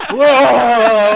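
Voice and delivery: loud, high drawn-out "whoa"; mock moan of rising anxiety; anxious wail. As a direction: act out an anxious freak-out noise. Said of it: "like, anxiety ridden".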